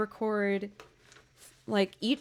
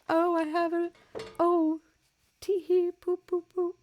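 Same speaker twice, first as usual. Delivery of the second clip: silly mocking voice; 8 semitones higher than usual. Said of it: "to be all like".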